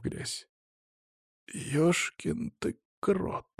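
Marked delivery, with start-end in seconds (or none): 1.5-3.5 slow, soft, high-pitched groaning exhale; pained sigh. A narrator's reading. Hurt boy getting up from the ground, pained sigh. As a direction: sigh it out slowly, winded. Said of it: "вздохнул он".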